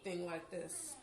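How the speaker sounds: normal talking voice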